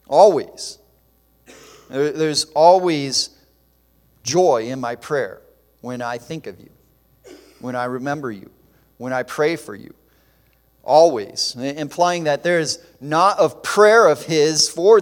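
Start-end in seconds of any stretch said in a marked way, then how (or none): 0.1-0.8 Always said loud and falling, preacher's emphasis; stressed Always. Real, not acted. Preacher to congregation, stressing Always loud and falling. 10.8-11.5 Always said loud and falling, preacher's emphasis; stressed Always. Real, not acted. Preacher to congregation, stressing Always loud and falling. Preacher repeats the key word.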